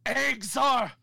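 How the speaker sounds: rising to a high, loud panicked shout; a villain horrified as doom strikes. Retold or imitated. Gnome King discovering the poison egg, building to a high panicked shout.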